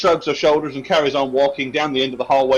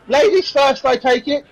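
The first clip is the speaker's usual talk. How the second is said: put-on put-upon serving girl's grudging question; sarcastic barmaid; 8 semitones higher than usual. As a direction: needle the guest about manners. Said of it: "She was, uh".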